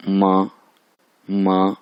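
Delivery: मा as one level syllable; first-tone blend. Shown as a demonstration. Teacher blending the sounds of मा, level first tone. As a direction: join m and aa in an even मा. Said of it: "initial का भी आवाज़ निकल रही है".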